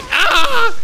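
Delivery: high, loud yelp; startled scream. Real, not acted. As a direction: scream out suddenly. Startled in the game.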